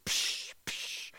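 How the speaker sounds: voiceless "psh" bursts; imitated sizzle. Preacher pictures God moving through his churches, sizzling noises. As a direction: puff out a short sizzling hiss. Imitating God's purifying steps.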